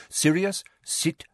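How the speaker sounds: firm, sharp order; high on the name, loud on the command. A narrator's reading. Lupin ordering Sirius back into his chair, sharp. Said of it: "said Lupin sharply".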